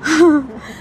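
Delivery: real brief chuckle; short laugh. Real, not acted. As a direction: let out a quick laugh. Laughs at companion's remark. (tawa singkat)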